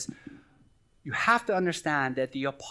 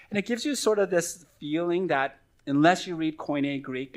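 usual speaking voice

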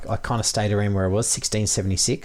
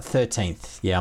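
ordinary delivery

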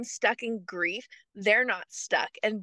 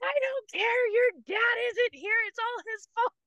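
much higher mock wail of frustration; a retold tearful shout, pitched way up; crying outburst; 12 semitones higher than usual. Widow crying over the broken fridge, high upset wail. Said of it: "I'm like".

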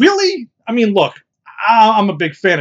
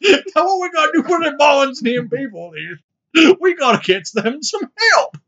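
put-on high, orating Southern-accent politician; 8 semitones higher than usual. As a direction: make a speech in a Southern accent. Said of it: "stuck in the Southern accent".

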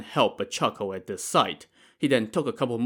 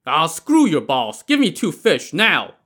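loud, high, scornful demand; scoffing; 5 semitones higher, 6 dB louder than usual. A narrator's reading. Li Kui brushing off the fishermen's boss, loud scoffing demand. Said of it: "Li Kui scoffed".

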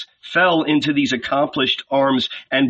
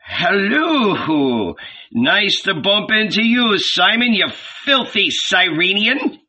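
put-on higher, cheerful call to an old friend; hearty greeting; 6 semitones higher than usual. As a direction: greet him with rough affection. Peter greets his drinking friend.

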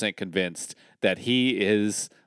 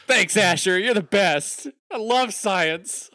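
higher, giddy thanks; gushing fan; 8 semitones higher, 3 dB louder, slower than usual. Real, not acted. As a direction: gush with delight.

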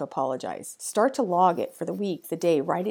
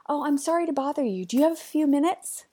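timid, apologetic ask, pitched higher; playing the sorry woman at the door; higher, apologetic office voice; apologetic employee; 7 semitones higher, 3 dB louder, faster than usual. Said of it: "She peeks her head in and says".

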